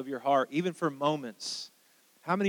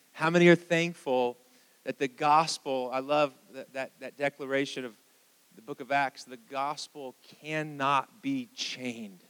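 ordinary talk

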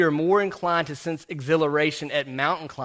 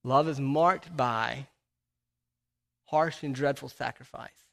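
solemn preaching; 2 semitones lower, 4 dB quieter than usual. Real, not acted. Preacher sums up love's sacrifice for the congregation, solemnly. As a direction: let each phrase sink in softly, with long pauses.

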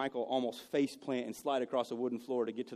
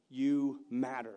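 slow, weighted you matter; slow emphatic; 2 semitones lower, much slower than usual. Shown as a demonstration. Pastor telling the congregation they matter, slow. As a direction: lean on You matter slowly.

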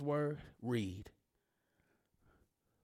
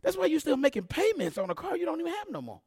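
higher-pitched preacher cadence, repeating that's why; preaching refrain; 13 semitones higher, 3 dB louder than usual. Acted. Preacher to congregation, higher rhythmic preaching. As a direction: drive each that's why home to the crowd.

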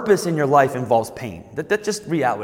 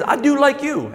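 put-on much higher little-boy voice; cute kid voice, pitched way up; cute five-year-old; 10 semitones higher than usual. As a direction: imitate a small child explaining himself. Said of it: "in a cute little five-year-old voice".

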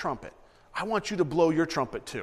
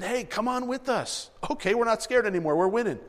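excited call; 6 semitones higher than usual. Retold or imitated. Gideon's excited message retold.

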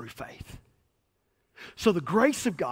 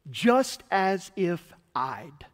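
slow; much slower than usual. Shown as a demonstration. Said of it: "Justified means this".

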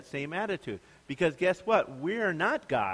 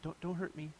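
timid whimper; 2 semitones higher, 13 dB quieter than usual. Pretend. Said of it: "stands in the corner and says".

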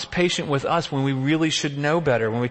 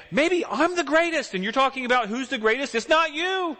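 exasperated; 11 semitones higher than usual. Retold or imitated. Jesus voiced, exasperated at disciples.

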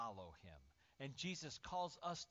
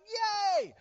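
very high, loud celebration; hammed-up overjoyed parent shouting; ecstatic cheering; 22 dB louder than usual. Parent thrilled at baby's first three steps, ecstatic cheering.

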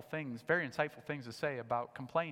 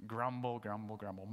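grumbling sound; 7 semitones lower, 5 dB quieter than usual. Shown as a demonstration. Preacher showing how grumble sounds, low mutter. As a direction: lower and softer, muttered grumble. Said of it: "The actual word sounds like the meaning".

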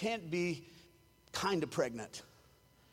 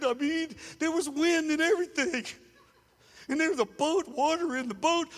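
high, put-on whining believer; a mock self-pitying voice; whiny complainer voice; 3 semitones higher than usual. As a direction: complain like a scared disciple. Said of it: "I'm stuck in this voice".

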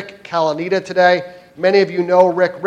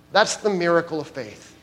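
ordinary talk

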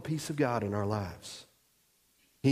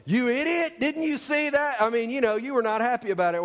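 high pitched mock outburst at the umpire; playful imitation of an angry ballplayer; mock angry yell; 7 semitones higher than usual. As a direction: yell it up high like a furious player. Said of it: "No, you go".

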